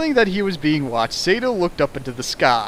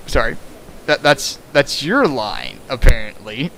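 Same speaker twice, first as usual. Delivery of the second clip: ordinary talk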